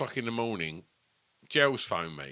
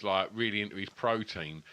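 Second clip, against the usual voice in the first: normal talking voice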